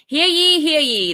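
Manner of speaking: mock court crier, high and loud; court crier call. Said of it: "that's how it all gets started".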